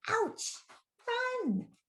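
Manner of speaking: very high, softened cry of pain; high mock panic, held back in volume; storybook alarm. A narrator's reading. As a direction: yelp it high, then urge them off. Bear stung, warns the others.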